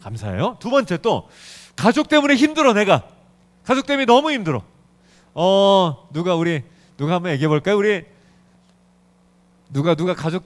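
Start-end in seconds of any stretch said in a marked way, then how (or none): 1.7-4.6 loud, raised-pitch mock grumble; acted complaint. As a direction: complain aloud, pitched up, hamming it up. Acts out a family complaint. (과장되게 하소연하는 말투)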